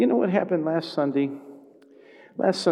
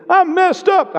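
high, loud cry of confession; higher, louder mock cry of a mess; pleading sinner; 11 dB louder, slower than usual. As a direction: wail it like a broken person.

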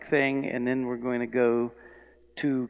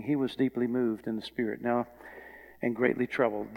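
usual speaking voice

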